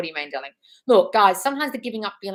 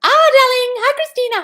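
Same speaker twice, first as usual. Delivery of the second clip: gushing; 13 dB louder than usual. Real, not acted. Live host greeting a viewer, gushing. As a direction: gush it like a theatrical diva.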